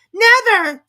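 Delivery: shrieking, furious high yell; a queen exploding with rage. A narrator's reading. The Queen at the King, a furious high shriek. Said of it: "said the queen furiously".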